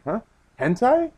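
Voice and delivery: high rising double take; baffled surprise. Retold or imitated. Frankie mishearing the pirates' order, baffled. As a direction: imitate a confused squeak, pitch shooting up.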